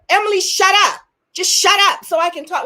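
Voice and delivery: high, loud mock telling-off; rude shut up. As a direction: bark it like someone rude cutting her off. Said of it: "how would you like it if someone said".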